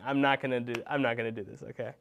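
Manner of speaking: plain reading voice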